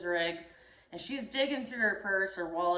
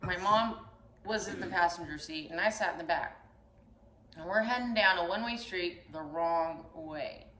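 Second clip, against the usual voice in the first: ordinary talk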